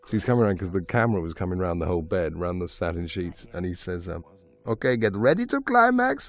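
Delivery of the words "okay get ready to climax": put-on high Hungarian-accented director's cue. Director preparing actors for love scene, high Hungarian accent. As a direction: warn them in a thick Hungarian accent, pitched up. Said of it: "in his Hungarian accent".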